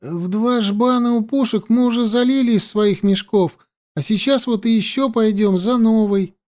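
high-pitched, ingratiating whine; whimpering plea. A narrator's reading. Water carrier justifying himself to the soldier, whimpering. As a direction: keep whining up high, meekly explaining. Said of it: "Правдоподобно захныкал Живан".

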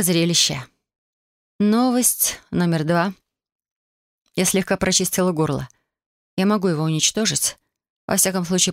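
ordinary delivery

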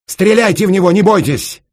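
very high, loud yell; desperate shout. A narrator's reading. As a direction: scream it desperately while held down. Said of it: "отчаянно крикнул Раст Петрович". (отчаянный крик)

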